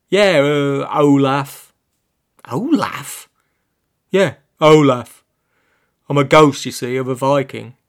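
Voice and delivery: a lightly voiced cheeky underpants ghost; casual, slightly evasive pants character; talking pants voice.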